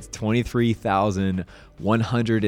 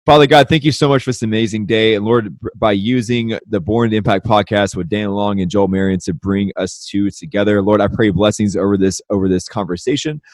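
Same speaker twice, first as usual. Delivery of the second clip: earnest, somewhat louder prayer; spoken prayer; 7 dB louder than usual. Acted. Host praying over the conversation, earnest prayer voice. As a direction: lead a heartfelt opening prayer.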